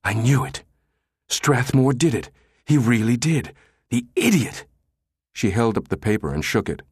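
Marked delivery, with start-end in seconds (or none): 0.0-4.7 higher-pitched, indignant astonishment; gasped outburst. A narrator's reading. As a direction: exclaim as if just proven right. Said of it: "she gasped".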